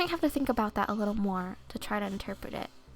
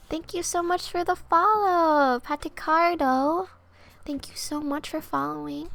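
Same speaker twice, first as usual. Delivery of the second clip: higher and louder, bursting with thanks; excited thanks; 6 semitones higher, 6 dB louder than usual. Real, not acted. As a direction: call out the thanks brightly and loud. Streamer thanks new follower.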